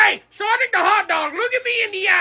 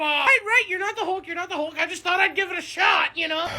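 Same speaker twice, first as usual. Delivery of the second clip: usual speaking voice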